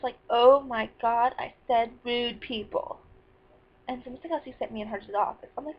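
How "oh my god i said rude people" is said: slow, emphatic, exasperated correction; retold exasperation. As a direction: spell it out to someone who misheard. Correcting accuser in retold quarrel.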